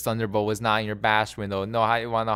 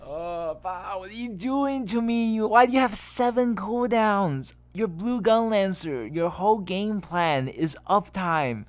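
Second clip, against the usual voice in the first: mock anguish; 10 semitones higher than usual. Real, not acted. Coach groaning at Bao's wasted cooldowns.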